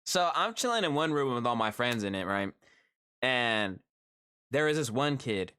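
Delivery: ordinary talk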